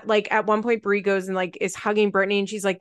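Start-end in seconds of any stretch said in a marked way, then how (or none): none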